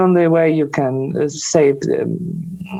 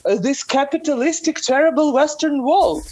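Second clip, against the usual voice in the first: higher, sarcastic sneer at the terrible West; mock the propaganda line with exaggerated scorn; ironic, raised-pitch jab; sarcastic mockery; 9 semitones higher than usual. Mocking the Russian view of the West.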